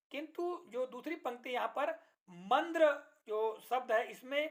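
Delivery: usual speaking voice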